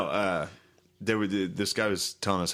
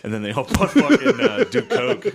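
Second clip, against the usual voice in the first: laughing; 11 semitones higher, 7 dB louder than usual. Real, not acted. Laughing through the joke.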